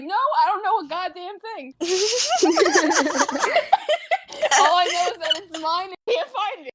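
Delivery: high, laughing protest; giggling through a frantic denial. Retold or imitated. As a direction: blurt it out while cracking up. Frantic denial, laughing mid-line.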